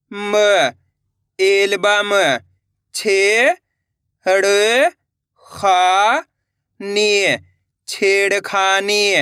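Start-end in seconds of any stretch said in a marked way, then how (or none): 2.9-9.1 slow blended reading. Shown as a demonstration. Teacher showing pupils how to blend, said slowly. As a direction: stretch each sound slowly into one word. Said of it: "देखो किस तरीके से".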